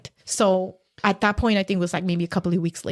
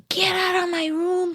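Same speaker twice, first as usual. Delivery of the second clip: retold scream; 10 semitones higher than usual. Husband storms in on wife's mother, retold scream. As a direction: imitate his screamed order without full volume. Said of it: "he comes in screaming, storming".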